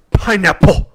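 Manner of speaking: Pineapple with hard, popping P sounds; popped P sounds. Shown as a demonstration. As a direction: spit the P in Pineapple into the mic. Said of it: "So if you are over the saying".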